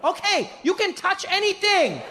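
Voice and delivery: high, slow, gleeful tour guide; drawn-out excited permission; Deng Basan. Acted. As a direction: invite the group in a high pitch. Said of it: "Deng Basan turns to me".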